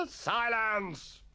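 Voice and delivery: high, drawn-out master's cry; thundered command. A narrator's reading. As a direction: call the rowdy dormitory to order. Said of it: "thundered Mister Wilkins".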